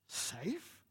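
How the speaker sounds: soft, rising echo of disbelief; incredulous. A narrator's reading. Mister Beaver echoes Lucy's question, incredulous. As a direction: query the word as if absurd. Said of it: "said Mister Beaver".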